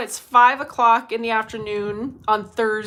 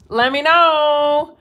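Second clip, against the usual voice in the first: slow, louder singsong let me know; drawn-out call; 4 semitones higher, 8 dB louder, much slower than usual. Acted. Host calling to live viewers, slow and drawn out. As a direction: stretch it out, calling to chat.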